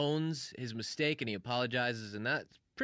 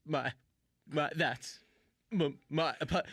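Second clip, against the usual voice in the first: mock stammer on repeated syllables; imitating a stuttering, broken apology; stuck in a loop; 3 semitones higher than usual. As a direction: stumble over each word as if stuck. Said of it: "He just got trapped in a loop".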